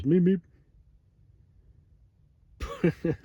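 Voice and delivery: high, loud cartoon bird call; Roadrunner meep. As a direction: chirp it like the Roadrunner. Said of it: "All he ever said was".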